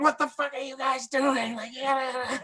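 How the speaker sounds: high, strained outburst; exasperated director yelling; mock angry director. Director yelling at the crew, mock exasperated. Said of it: "He's like".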